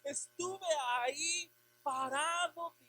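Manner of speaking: ordinary talk, nothing marked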